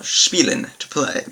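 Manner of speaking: normal talking voice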